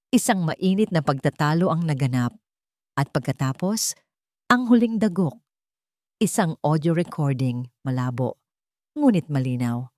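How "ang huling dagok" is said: ordinary talk, nothing marked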